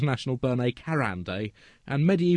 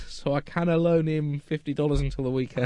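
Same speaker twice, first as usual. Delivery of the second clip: usual speaking voice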